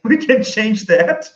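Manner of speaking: high, slow, laughing through it. Real, not acted. Guest laughing to the host about AI. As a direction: chuckle out the hope.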